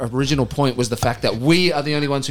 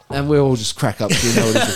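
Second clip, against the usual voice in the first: ordinary talk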